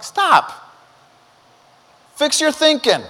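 urging shout. Retold or imitated. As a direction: bark it like a coach on the course. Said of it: "But I'm like".

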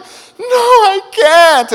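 very high, loud, slow wail; wailing kid. Retold or imitated. Preston wailing at the instructor, very high. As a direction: wail like a terrified little boy. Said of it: "Preston's over here going".

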